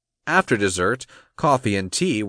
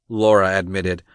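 plain reading voice

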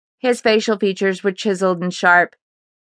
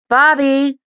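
higher-pitched call through the house; 7 semitones higher, 3 dB louder than usual. A narrator's reading. Mother calls to her son from the front door, calling out. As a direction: call for someone in another room. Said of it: "she called out".

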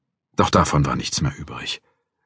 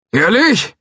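put-on high, loud, slow old man threatening; a cranky old guard threatening to call police; old watchman; 5 dB louder than usual.

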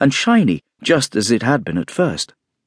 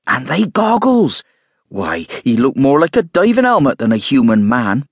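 can't believe what she saw; astonished exclaim; 4 semitones higher, 3 dB louder than usual. A narrator's reading. Mrs Hall exclaiming.

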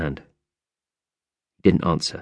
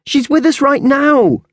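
high, louder, slow insistence; desperate cry; 5 dB louder than usual. Acted. Isis begging her mother to believe her, high loud slow cry. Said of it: "cried Isis".

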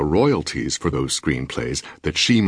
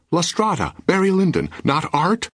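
much higher, listing the films in offended disbelief; husband protesting to his wife, high and indignant; indignant cry; 14 semitones higher than usual. A narrator's reading. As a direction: cry out the titles as proof, rising high. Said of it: "I cried".

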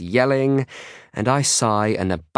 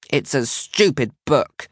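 put-on higher, slow sulky child's protest; 6 semitones higher, slower than usual. A narrator's reading. Isla defending herself to big sister, protesting. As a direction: protest in a little girl's voice. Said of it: "she protests".

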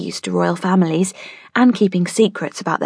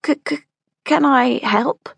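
stutter; 7 semitones higher than usual. Acted. Nesta stammering at the sight of the lady. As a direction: trip over the first sounds, nervously. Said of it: "stuttered Nesta eventually".

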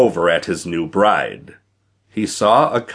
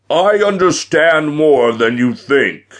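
loud, high-pitched giant's boom; boastful giant; 7 semitones higher, 5 dB louder than usual. A narrator's reading.

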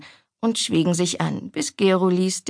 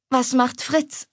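higher, would-be casual question; 6 semitones higher, slower than usual. A narrator's reading. Daughter asking mother after half-brother, trying to sound casual. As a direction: ask lightly, as if in passing. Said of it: "dass es leichthin klang".

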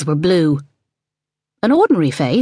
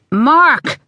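sharp call; 9 semitones higher, 4 dB louder than usual. A narrator's reading. Mother cutting son off.

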